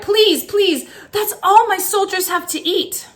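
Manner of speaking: high, loud begging cry; cried plea. Acted. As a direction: beg him desperately. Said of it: "he cried".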